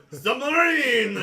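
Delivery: high, stretched-out shout; very loud yell. Acted. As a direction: belt it out long and high. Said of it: "It's a very loud".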